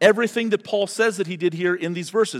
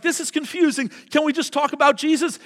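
higher, whiny confused congregant voice; pitched up mock complaint from the pews; mock confused listener; 8 semitones higher than usual. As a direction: play a puzzled listener begging for Jesus. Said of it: "Because you're like".

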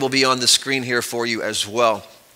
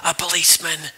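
put-on softer hushed child voice; child's whisper; 6 dB quieter than usual. Child whispering to the boss about a policeman. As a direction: keep it hushed and small. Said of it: "whispered the child".

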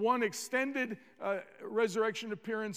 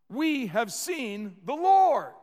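excited; 7 dB louder, slower than usual. Acted. Disciples tell Thomas excitedly.

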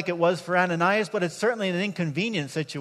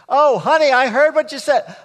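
higher, louder chatty busybody voice; nosy roommate; 7 semitones higher, 9 dB louder than usual. Retold or imitated. Chatty roommate butting into private talk, higher louder sing-song. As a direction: barge in brightly as the nosy neighbor. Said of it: "this lady's just in our conversation".

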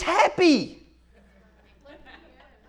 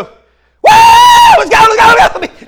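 whooping, high, very loud fan yell; screaming and hollering; 13 semitones higher, 13 dB louder than usual. Acted. Reserved fan swept up by the stadium, hollering. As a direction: holler like a fan caught up in the frenzy. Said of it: "screaming and hollering".

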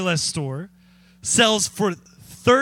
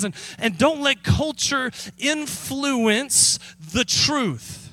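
higher, raised preaching voice; 4 semitones higher than usual. Acted. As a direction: preach Paul's charge to the church. Preaches Paul's message.

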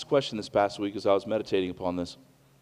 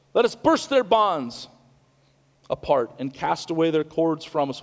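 bold defiant declaration; 6 semitones higher, 5 dB louder than usual. Acted. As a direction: throw off the chains in defiance. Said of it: "this is man's bold declaration".

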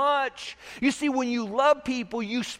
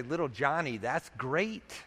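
softer, lower, sweetly permissive little Johnny; mock indulgent permission; 7 semitones lower, 6 dB quieter than usual. Preacher voicing a permissive parent, softly indulgent. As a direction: coo it like a parent who can't say no.

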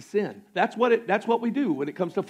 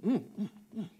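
tempted murmur; 11 dB quieter, faster than usual. Retold or imitated. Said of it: "I'm like".

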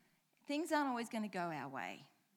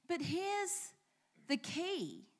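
normal talking voice

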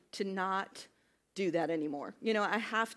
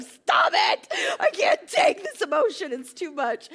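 high, loud mock wail to her husband; overwhelmed outburst; 9 semitones higher, 9 dB louder, slower than usual. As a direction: cry out as if you can't take any more, easing off at the end. Retold outburst at blubbering husband.

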